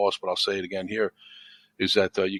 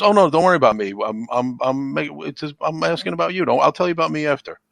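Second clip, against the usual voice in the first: higher, louder waving away of concern; retold brush-off; 7 semitones higher, 6 dB louder than usual. Hospitalized friend turning the talk back to him, brushing off concern. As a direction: imitate a friend deflecting the worry insistently.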